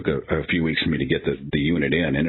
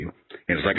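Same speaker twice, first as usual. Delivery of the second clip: ordinary talk, nothing marked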